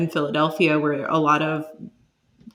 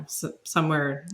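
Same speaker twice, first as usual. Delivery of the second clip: usual speaking voice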